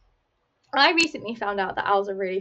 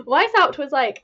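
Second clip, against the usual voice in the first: laughing; 5 semitones higher, 6 dB louder than usual. Real, not acted. Laughing while telling story.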